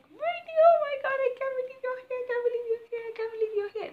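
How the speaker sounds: very high, loud re-enacted shriek; overjoyed squeal. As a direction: squeal in disbelief as you call out to her. Re-enacts calling out to Brittany.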